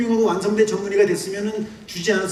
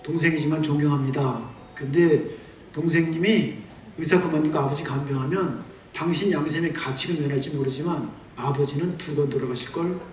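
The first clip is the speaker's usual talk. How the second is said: low, quiet sister's rebuke; a hint of a stern older sister pleading; older sister quietly scolding her brother, soft and low; lightly voiced older sister; 5 semitones lower, 5 dB quieter than usual. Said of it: "누님이 와가지고".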